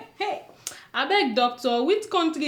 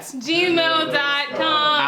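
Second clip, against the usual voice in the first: a long lazy call of the address; drawn-out sign-off; 3 semitones higher, much slower than usual. Acted. Co-host drags out the email address, very slow. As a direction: stretch each word out very slowly.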